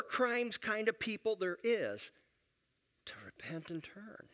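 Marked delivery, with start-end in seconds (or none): 3.1-4.3 whispered, drawing them in. Real, not acted. Preacher to congregation about God's longing, whispered. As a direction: hush it like a secret plea.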